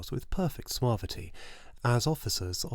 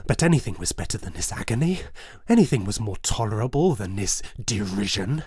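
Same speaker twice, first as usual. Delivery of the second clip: usual speaking voice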